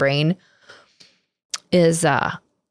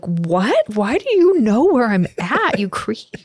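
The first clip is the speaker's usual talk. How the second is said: high, slow, indignant sister voice; outraged sister; 4 semitones higher, slower than usual. Retold or imitated. Daughter snapping at her tracking brother, high and indignant. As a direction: play the annoyed college sister caught out. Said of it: "and she's like".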